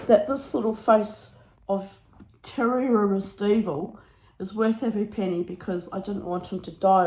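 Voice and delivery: ordinary delivery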